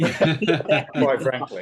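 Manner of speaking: laughing, drawn out. Real, not acted. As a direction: stretch it out slowly through a laugh.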